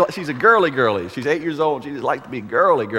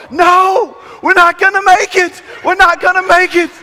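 put-on much higher, loud fearful kids' wail; frightened kids; 9 dB louder than usual. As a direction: cry out that you won't make it. Kids afraid before the mud pit.

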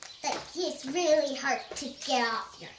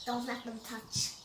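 ordinary delivery

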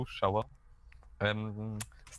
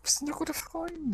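creepy sing-song; slower than usual. Pretend. Creepy fake-friendly.

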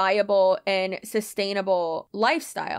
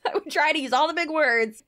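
usual speaking voice

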